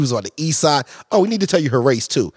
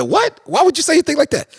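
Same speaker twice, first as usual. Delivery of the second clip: high, fast what, indignant; retelling his shocked reply, pitched high; mock outrage; 9 semitones higher than usual. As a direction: play it up, shocked and rushed. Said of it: "I said".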